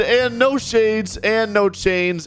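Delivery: high, slow, emphatic list of rules; yelling teacher. Retold or imitated. Strict teacher listing banned items to student, mock yelling. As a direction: lay down each rule as a mock yell. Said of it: "Why is he still yelling?".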